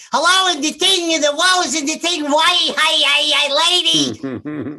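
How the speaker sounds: very high, loud zany gibberish; Jerry Lewis. Acted. Comic translates a dead comedian's joke, manic squawking impression. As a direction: squawk manic nonsense, stretching the last word. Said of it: "here's the joke that he just told me".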